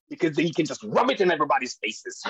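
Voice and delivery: a hint of a cheeky kid, fast; son's voice. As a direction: toss off the punchline like a smug kid. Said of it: "He's like".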